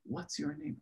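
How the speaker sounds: "What's" said softly as wɔts, no ch; quiet "What's your name" with a clear ts; friend's clear version. Retold or imitated. Friend repeats the question to him, softly and clearly. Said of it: "perguntar pro meu amigo".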